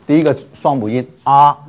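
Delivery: ordinary talk, nothing marked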